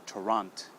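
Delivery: "Torrent" as Toronto with the last o missing; final O cut. Shown as a demonstration. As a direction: stop on the t, dropping Toronto's final o. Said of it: "cut that O sound".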